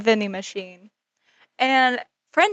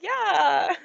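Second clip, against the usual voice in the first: nervous yeah; 9 semitones higher than usual. Retold or imitated. Nervous answer to creepy player.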